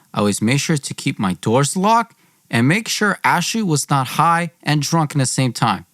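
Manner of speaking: usual speaking voice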